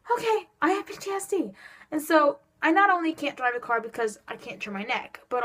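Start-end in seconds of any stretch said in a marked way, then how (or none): 0.1-1.5 high, sing-song "Okay, I have P T S D"; giving in with ironic brightness; mock resigned acceptance. To mom, a high mock-resigned admission. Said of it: "I'm just like".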